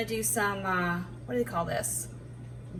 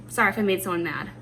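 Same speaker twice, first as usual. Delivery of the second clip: normal talking voice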